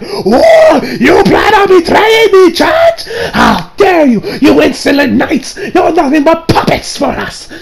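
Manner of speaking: high, hammed-up outrage; theatrical squealing rant; ranting villain. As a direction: shriek indignantly like a betrayed cartoon villain. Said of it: "the dude goes on on this rant".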